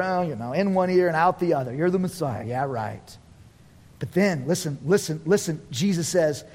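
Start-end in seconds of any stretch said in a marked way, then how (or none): 0.0-3.2 low, soft brush-off; dismissive scepticism. Acted. Religious leaders hearing Jesus' claim, dismissive scepticism. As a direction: wave it away as unbelievable. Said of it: "religious leaders are like they always were".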